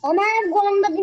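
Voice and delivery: loud, high, rushed pupil outburst; excitedly blurting it out; eager calling out. Real, not acted. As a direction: burst in fast and loud to be heard. Pupil calls out over the class.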